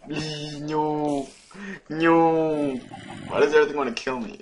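high, loud whining begging; desperate high-pitched plea; frantic pleading. Real, not acted. Pleading with mobs.

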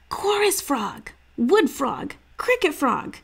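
put-on a kid blurting out guesses to his sister; young boy guessing. A narrator's reading. Boy guessing frog names for his sister, eager and high. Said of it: "Stink guessed".

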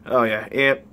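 ordinary talk, nothing marked

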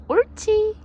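high, loud praising cry; praise for a dog. Shown as a demonstration. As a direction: cheer the dog on brightly. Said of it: "이렇게".

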